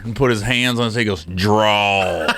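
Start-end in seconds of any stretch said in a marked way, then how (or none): 1.2-2.3 mock cowboy drawl. Cowboy voiced in story, low.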